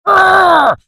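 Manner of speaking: a sudden loud, high yell after a crash. Acted. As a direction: yell out as something falls. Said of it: "he yelled".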